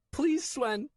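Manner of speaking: put-on high boy's voice, crying plea; crying child. Nico crying to Swen, high crying plea. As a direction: beg through tears. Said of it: "I started to cry".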